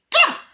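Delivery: very high, loud yelp; startled shriek. Retold or imitated. Chucky waking in shock, a high shriek. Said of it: "Chucky goes".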